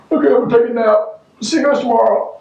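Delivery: high put-on little voice; playful sign-off. Pretending to sign off for nap.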